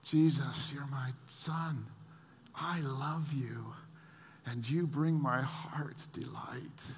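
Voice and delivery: very soft, slow, tender voice; gentle near-whisper, savoring each phrase; loving Father. Acted. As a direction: say it hushed and intimate to a beloved son. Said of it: "taking something away from the Father".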